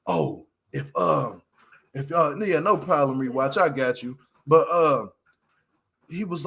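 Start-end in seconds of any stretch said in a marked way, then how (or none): 0.0-4.2 much lower, lazy mock voice of the guy; lowered, laid-back mock dude voice; imitating the dude. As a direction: play the bartender offering a shot.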